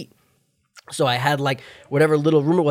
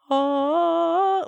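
high stunned ah. Retold or imitated. Recalling silent moment mid-roll.

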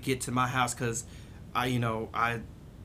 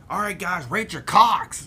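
mock brash woman; 6 semitones higher, 6 dB louder than usual. Retelling woman's crude question.

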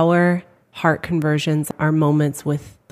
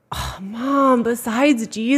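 put-on exasperated kid; 7 semitones higher, slower than usual. Kid's exasperated reply voiced.

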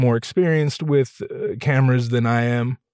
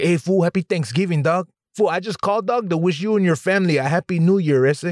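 higher, Chicano homeboy voice with a laid-back swagger; Chicano accent; 6 semitones higher than usual. Retold or imitated. Host imitating his Chicano friends' holiday wishes in their accent. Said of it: "hearing them say, like, normal people things".

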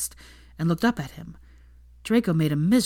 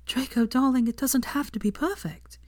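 tender; 7 semitones higher than usual. A narrator's reading. Hermione tearfully reassuring him.

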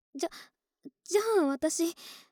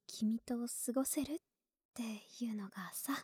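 shy whisper; 9 dB quieter than usual. Acted.

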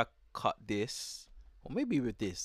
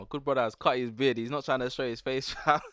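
teasing; 5 semitones higher, 6 dB louder than usual. Real, not acted. Teasing repeat, laughing.

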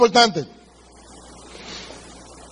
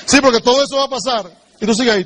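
ordinary talk, nothing marked

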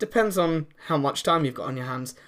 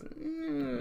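dismissive dad grunt; 13 semitones higher, 10 dB quieter than usual. Retold or imitated. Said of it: "he goes".

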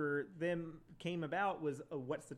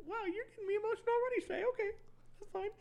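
much higher, fast, mock-teary gush; mock emotional; faster than usual. Viewer reacting to Shay's pep talk, mock emotional. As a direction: gush like you're about to cry, hammed-up. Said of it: "And I'm like".

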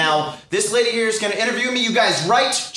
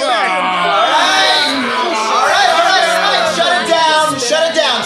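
shouting over class; 7 semitones higher, 6 dB louder, slower than usual. Acted. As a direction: shut down a noisy classroom.

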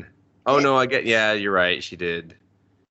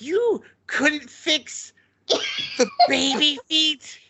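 exasperated disbelief; much slower than usual. Retold or imitated. Retold outrage at the redraw.

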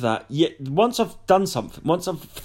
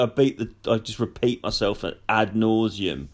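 ordinary talk

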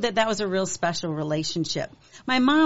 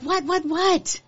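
put-on excited kids; 10 semitones higher, slower than usual. Kids eager, curious.

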